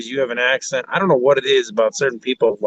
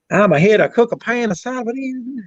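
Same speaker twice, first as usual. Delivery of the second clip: mock Southern accent; 11 semitones higher than usual. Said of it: "You talk".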